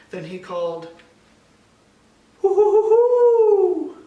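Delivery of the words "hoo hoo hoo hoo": high, loud hooting call of a great horned owl. A narrator's reading. Pa calling out to the owl with a loud, high hoot. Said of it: "The sound of a great horned owl".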